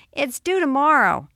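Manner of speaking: put-on high, loud kid voice; acted child's insistent plea; young boy. A narrator's reading. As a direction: press Mom urgently like a ten-year-old. Ten-year-old pressing busy mother.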